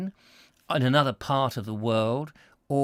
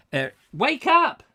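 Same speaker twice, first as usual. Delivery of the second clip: high, loud wake-up call; calling out to wake someone; 10 semitones higher, 5 dB louder than usual. Acted. Host calls to Eric to wake up, raised wake-up call. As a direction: rouse a sleeper with a raised, higher voice.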